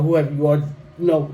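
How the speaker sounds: ordinary delivery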